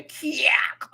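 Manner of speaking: "Kiak" squawked high as kee-ACK; high, exaggerated comic "Kiak"; comic pronunciation. Shown as a demonstration. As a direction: yelp "Kiak" high with a stretched second syllable. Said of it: "something about hearing it that kind of way".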